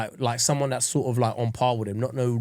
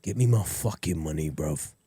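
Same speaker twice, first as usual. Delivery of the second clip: lower, softer menacing bruv voice; London gangster; 10 semitones lower, 6 dB quieter than usual. Pretend. A Kidulthood gangster demanding his money, low and quiet. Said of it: "where they had a guy like".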